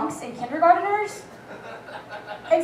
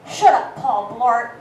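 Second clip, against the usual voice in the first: put-on drunk sass; 4 semitones lower, 3 dB louder, slower than usual. Drunk reply to cop.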